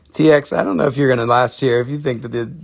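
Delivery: ordinary talk, nothing marked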